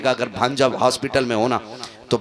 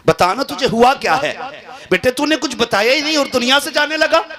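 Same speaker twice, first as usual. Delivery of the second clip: a high, loud cry of a pleading father; desperate plea; 14 semitones higher, 5 dB louder than usual. Retold or imitated. Father begging his dying son to speak, a high loud cry. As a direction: beg him, voice lifted high and loud.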